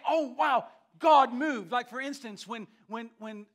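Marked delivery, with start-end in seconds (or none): 0.1-1.7 imitating amazed onlookers, higher and much louder; big preacher's mock wonder; awed exclamation. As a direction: exclaim it loudly, as if astonished. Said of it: "Man could say".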